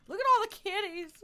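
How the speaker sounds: high, loud excited cry; delighted shriek. Real, not acted. Streamer spotting cats in a village, squealing. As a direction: squeal over the kitties.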